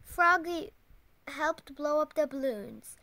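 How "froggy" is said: ordinary delivery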